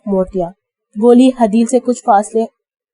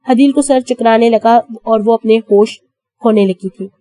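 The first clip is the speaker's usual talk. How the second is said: usual speaking voice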